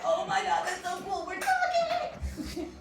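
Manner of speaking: rising high and giddy into a laugh; thrilled squeal. Retold or imitated. New parent thrilled that her baby might sign back, giddy squeal. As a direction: gush like a new parent hearing a first word.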